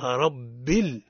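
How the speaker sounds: رب ال- drawn out slowly with a light, doubled ب; model recitation. Shown as a demonstration. Teacher leading students in رب ال-, slow and light. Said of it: "مرقق الباء ومشددة".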